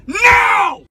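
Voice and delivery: loud sharp voice. Real, not acted. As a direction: roar the order in pure fury. Driver yelling at passenger.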